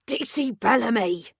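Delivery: sharp hissed warning name; officer's hiss. A narrator's reading. Officer warning the detective, hissed. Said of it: "one of the armed officers hissed".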